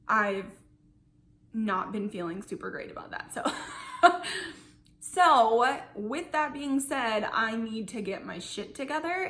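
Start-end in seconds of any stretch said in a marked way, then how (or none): 3.5-4.5 short unvoiced laugh; a quick breathy chuckle. Real, not acted. Nervous laugh about health.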